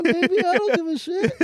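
high-pitched, laughing brag; gleeful bravado. Real, not acted. Comic bragging about the accent he would do, gleeful bravado. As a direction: crow it way up high.

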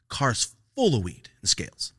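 ordinary delivery

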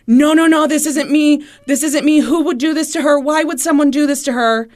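high, loud, distraught pleading; retold sobbing. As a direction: imitate a man wailing his disbelief. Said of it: "he's just sobbing, saying".

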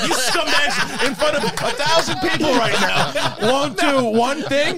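high, hammed-up furious shouting; abusive rant. Host berates a crowd as garbage, ranting. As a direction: yell insults, drawing the words out.

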